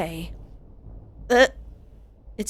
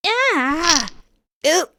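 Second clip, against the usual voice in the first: high yelp of disgust; pained cry; 9 semitones higher, 5 dB louder than usual. Acted. Captive crying out in pain and disgust. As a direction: yelp as the finger pops.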